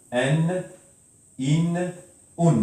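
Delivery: N, in, un said as separate sounds; name each nunation sound slowly for learners; tanwin endings. Shown as a demonstration. Teacher to class, sounding out each ending clearly. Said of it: "A I O".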